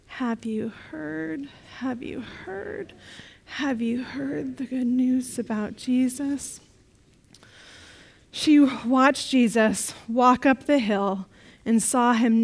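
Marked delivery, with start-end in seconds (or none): 0.1-6.6 softer, slow and sad; heavy-hearted, barely lifting the words. Acted. Mary telling friends sad news, soft and sad. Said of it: "So in a very sad voice".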